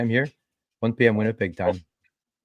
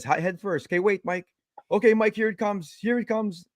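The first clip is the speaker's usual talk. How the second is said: higher, tense coaching; excited urging; 10 semitones higher than usual. Retold or imitated. Angler coaching Mike as the fish comes, higher and tense. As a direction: talk the netter in, pitch raised. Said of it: "he says".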